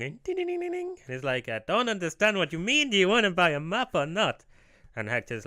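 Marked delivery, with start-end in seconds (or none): 0.2-1.0 very high rapid dinging; imitated bell. Man in a chair ringing his bell, high dinging. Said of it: "he's ringing his little bell". 1.5-4.4 high, loud, exasperated cartoon salesman; mock accent, pitched up and impatient; Tingle. Map seller pressing a silent customer, high impatient voice. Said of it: "how Tingle sounds".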